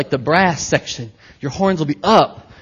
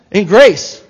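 excited loud; 4 semitones higher, 9 dB louder than usual. Real, not acted. Swept up in preaching.

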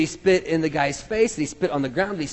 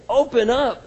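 higher, louder urgent order; forceful command; 7 semitones higher, 6 dB louder than usual. Acted. Jesus commands the deaf man, loud and insistent. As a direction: command it to open. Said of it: "It's a command".

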